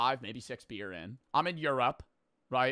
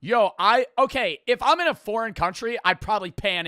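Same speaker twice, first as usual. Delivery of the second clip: loud, high excited rant; fired up and loud; 7 semitones higher, 9 dB louder than usual. Real, not acted. Excited rant about answer.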